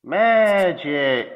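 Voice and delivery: excited. Pretend. Playful exit line.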